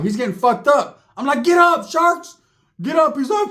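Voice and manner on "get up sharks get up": high, loud cheering yell; retold yell. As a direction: shout your buddy back to his feet. Yelling at friend in fight.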